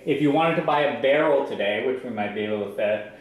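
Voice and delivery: usual speaking voice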